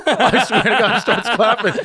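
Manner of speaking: loud, high, laughing through the words; laughing hard. Real, not acted. Swearing to friends the story is true, laughing. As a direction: barely get it out for laughing.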